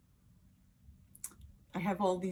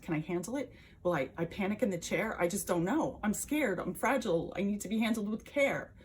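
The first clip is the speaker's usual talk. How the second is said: mounting panic; 2 semitones higher, 4 dB louder than usual. Acted. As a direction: let the worry spill out, voice rising. Panics imagining close salon contact.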